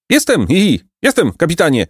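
high, chuckling roll-call answer; giggling bandit. A narrator's reading. Chubby bandit answers the captain, giggling. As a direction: answer through acted giggles. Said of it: "mówił śmiejąc się zbójca".

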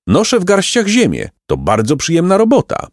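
put-on high, bright-hearted old peasant voice; cheerful peasant. A narrator's reading. Peasant reassures the two travelling boys, high and kindly.

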